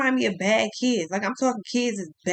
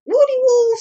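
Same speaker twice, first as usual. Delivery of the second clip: sing-song call; 13 semitones higher, 9 dB louder than usual. Pretend.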